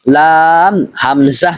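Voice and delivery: long held "লাম", then "হামজা"; correct careful reading. Shown as a demonstration. Teacher reading the earlier pair correctly, "লাম" drawn out. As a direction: stretch "লাম" before a clean "হামজা". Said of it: "আর পূর্বের হরফের উচ্চারণ হবে".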